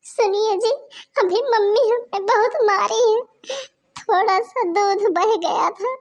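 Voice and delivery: wailing slowly to her husband; sobbing appeal after the beating; loud tearful plea. Acted. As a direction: cry out the complaint. Beaten wife pleads to husband.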